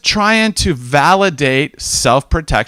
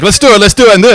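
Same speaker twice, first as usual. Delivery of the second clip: higher and louder, all gung-ho; eager rush; 8 semitones higher, 10 dB louder, much faster than usual. Retold or imitated. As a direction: pump yourself up to jump in. Acting own impulsive eagerness.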